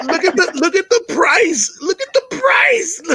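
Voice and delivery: very high, laughing shouted pitch; Walmart salesman. Retold or imitated. Hyping the price to a reluctant mother like a Walmart clerk. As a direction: sell it hard through the laughter. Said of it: "he like a salesman at fucking Walmart".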